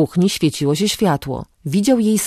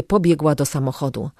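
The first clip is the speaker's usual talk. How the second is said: plain reading voice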